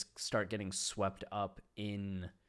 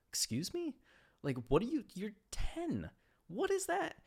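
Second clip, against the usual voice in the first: rising higher with each incredulous question; outraged disbelief; 13 semitones higher than usual. Real, not acted. Speaker reacting to a ten-year-old catcalling, shocked and very high. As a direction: pitch climbing way up, scandalised.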